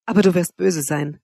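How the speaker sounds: usual speaking voice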